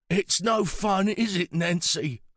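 put-on high, drawn-out old man's voice; weary old man. A narrator's reading. Pritch appealing to his friend Nancy, slow old voice. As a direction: complain slowly as a frail elder.

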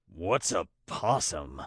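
low creature voice, asking; Snowclaw puzzled. Acted. Snowclaw asks about possum, low puzzled creature voice. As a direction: ask it deep down, as a puzzled beast.

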